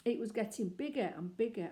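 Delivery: ordinary delivery, nothing marked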